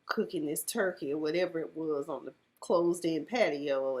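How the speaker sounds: usual speaking voice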